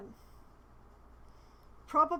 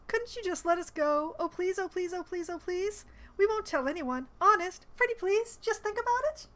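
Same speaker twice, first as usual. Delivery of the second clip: higher, pleading whine; mock begging; 6 semitones higher, 4 dB louder than usual. Player reading a grovelling plea to the commander, higher. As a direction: beg shamelessly, pretty please.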